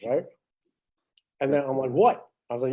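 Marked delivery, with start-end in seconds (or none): 1.9-2.3 higher, louder startled "what"; a kid caught off guard; surprised "what". Retold or imitated. Kid invited to the lockers, surprised higher "what".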